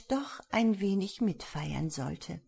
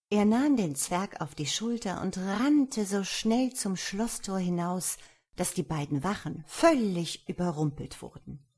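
usual speaking voice

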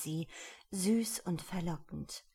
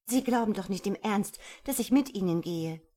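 loud, incredulous pushback; indignant refusal; 3 semitones higher, 7 dB louder than usual. A narrator's reading. Woman refuses to go with the man, indignant. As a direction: raise the voice in disbelief. She rejects his Paris demand.

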